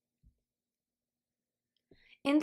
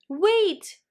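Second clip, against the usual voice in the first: higher, louder call to stop her; cried out; 8 semitones higher, 5 dB louder than usual. Acted. Cherry calling after Little Witch, a cry. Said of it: "cried Cherry".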